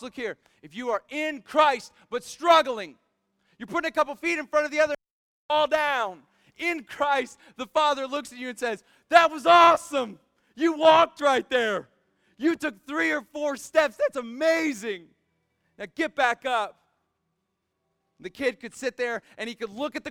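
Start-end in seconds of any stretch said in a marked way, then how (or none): 0.0-8.8 loud, high-pitched impassioned preacher; full-force preaching. Real, not acted. 9.1-16.8 loud, high encouragement; cheering proud father. Acted. God as father cheering fallen child.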